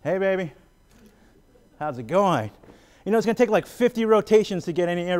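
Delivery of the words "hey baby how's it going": round dance pickup line. Retold or imitated. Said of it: "it's like this".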